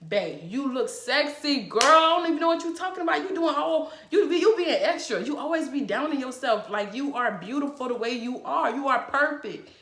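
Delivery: playful, laughing pep talk; hyping up a friend. Retold or imitated. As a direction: gush over her like a best friend talking her up. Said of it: "Some women need you to say".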